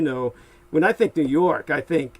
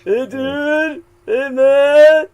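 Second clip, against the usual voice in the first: high, loud mock California stoner; imitating a surfer dude, loud and high; mock stoner greeting, pitched up; 11 semitones higher, 11 dB louder, much slower than usual. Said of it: "sounds like a stoner accent".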